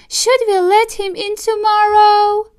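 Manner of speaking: wife defending the fox to her husband, squeaky mouse voice; small mouse wife. Acted. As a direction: pipe it up like a tiny mouse lady. Mrs DeSoto's voice.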